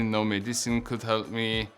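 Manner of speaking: ordinary talk, nothing marked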